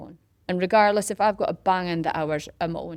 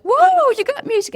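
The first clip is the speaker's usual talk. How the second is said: high, loud mimicked whoop; excited; 10 dB louder than usual. Runners discover there is music, excited. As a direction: imitate thrilled runners marvelling. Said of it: "And they're so excited".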